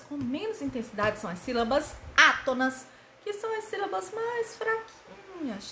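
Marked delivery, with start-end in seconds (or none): 3.2-5.7 high, soft and weak. Shown as a demonstration. Soft demo of weak syllables.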